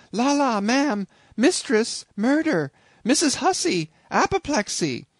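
high, loud panicked cries; chambermaid crying out. A narrator's reading. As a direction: shriek for the mistress in alarm. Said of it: "with these cries".

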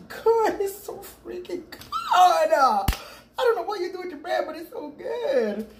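high, gushing praise with a laugh; excited raving. Real, not acted. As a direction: rave about how good it tastes. Raving about the bread.

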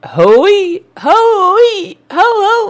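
high ululating cry of joy; mock zaghrouta. Ululating in celebration for the bride. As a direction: let out a joyful wedding trill. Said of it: "المفروض تزرغطيلي".

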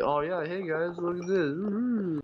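lightly hammed-up cheerful streamer; mock youtuber voice. Imitating herself talking to an empty recording. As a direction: play the upbeat host to nobody. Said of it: "I've just been talking to myself".

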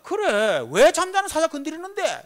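loud, high mock rage at his wife; angry retort. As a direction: snap back like a roused lion. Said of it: "이러면서". (아내에게 화내던 자신을 흉내내며 높고 크게)

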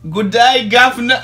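high, loud British caricature; hammed-up British greeting; British accent.

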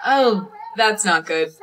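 usual speaking voice

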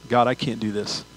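usual speaking voice

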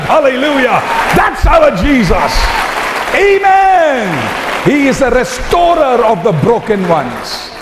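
soaring pulpit preaching; preaching at full force. Acted. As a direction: lift the room into a hallelujah.

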